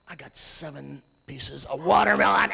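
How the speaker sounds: lightly voiced delighted child; a hint of a child bragging; proud little kid. Little kids boasting.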